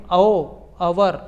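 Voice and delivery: ओ, अवर stretched slowly, opening on the o vowel; ओ, अवर said slow and deliberate; drawn-out sound demo. Shown as a demonstration. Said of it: "आवाज़ को देखेंगे".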